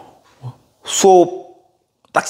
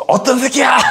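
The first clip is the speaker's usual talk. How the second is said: laughing yell; 14 semitones higher than usual. Retold or imitated. Student yelling with tongs.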